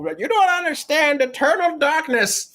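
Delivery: lightly hammed-up grumpy grandpa; indignant old gamer. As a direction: play a grandpa defending his old games. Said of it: "Still telling people".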